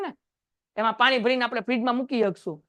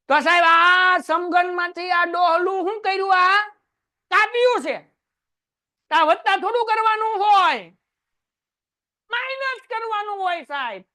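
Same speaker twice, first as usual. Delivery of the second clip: cheeky pupil; 5 semitones higher than usual. Pretend. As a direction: voice a bold student questioning sir. Teacher voices a pupil's question.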